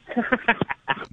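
real trailing laugh. Real, not acted. Caller laughing at own jab at the host, laughing.

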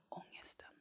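ominous whisper. Acted. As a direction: breathe the word like a dread. Dramatisk paus, viskat ord. (viskat, olycksbådande)

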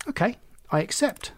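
high, slightly nervous acceptance. A narrator's reading. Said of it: "said Oliver nervously".

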